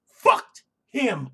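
sudden shout. Real, not acted.

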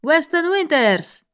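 slow, high drawn-out name call; announcer calling a name. A narrator's reading. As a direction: call the contestant out slowly. Announcer calls next contestant.

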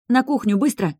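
urgent command. A narrator's reading. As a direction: bark it as a command. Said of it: "скомандовала я".

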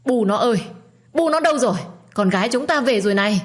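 loud, high excited calling; shouting while running. A narrator's reading. Father running to the gate calling his wife, high loud shout. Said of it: "vừa chạy vừa hét".

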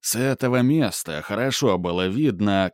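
ordinary delivery

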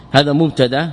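usual speaking voice

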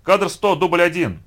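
high, loud clapper call; loud, ringing slate call; shouted announcement. A narrator's reading. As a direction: shout out the take. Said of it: "крикнув".

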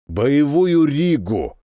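drawn-out, pleased announcement. A narrator's reading. Captain tells of spotting a battle Riga, pleased. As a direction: savour the news, slowly. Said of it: "произнёс это довольным голосом".

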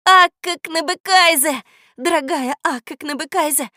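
put-on boy joyfully greeting the goose who came to save him; joyful cry. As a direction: call out in delight. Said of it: "радостно закричал я".

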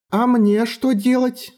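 put-on high, slow little girl voice; a strange child asking slowly; eerie child voice. Eerie child Elva asks.

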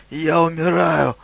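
labored, croaking creature; wheezed out with difficulty; dying wheeze. A narrator's reading. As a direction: force each word out like a dying man. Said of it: "прохрипел Водяной, с трудом выговаривая каждое слово".

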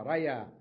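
ريا called out loud; loud example word. Shown as a demonstration. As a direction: punch out ريا. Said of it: "للمثنى ماذا أقول لهما". (نطق ريا بصوت عال)

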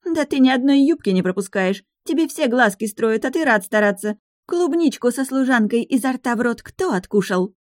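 jealous rage. Acted. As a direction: hurl jealous accusations. Jealous fiancée accusing.

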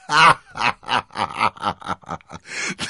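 long, loud falling belly laugh; booming laugh. A narrator's reading. Cardinal reads his own warrant, booming laugh. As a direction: roar with laughter. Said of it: "ein dröhnendes Lachen".

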